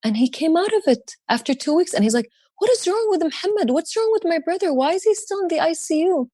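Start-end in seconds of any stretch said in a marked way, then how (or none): 2.6-6.3 much higher, pleading uncle voice; a high, anxious retold uncle; imitating worried uncle. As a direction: play the uncle begging for news of his brother. Uncle asking about his brother.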